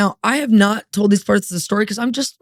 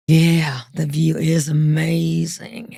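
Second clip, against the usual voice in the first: slower, lower suggestive voice; suggestive purr; 4 semitones lower, much slower than usual. Retold or imitated. Wife hinting at something more, slow and lower. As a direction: lean in, slow and loaded. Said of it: "and goes".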